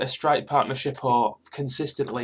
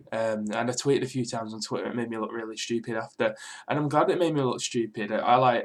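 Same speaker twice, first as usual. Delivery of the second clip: ordinary delivery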